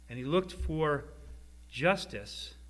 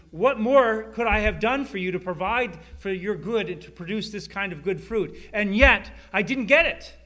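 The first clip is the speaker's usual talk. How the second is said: rising louder and higher to a cry; building outcry; 4 semitones higher, 7 dB louder than usual. Acted. Preacher voicing God's disappointment, building to a loud cry. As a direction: build God's frustration to a peak. Lord's building frustration in sermon.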